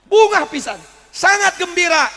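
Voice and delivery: preacher celebrating the rescue; joyful exclamation. Acted. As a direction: burst out with her happiness. Preacher exclaims her joy.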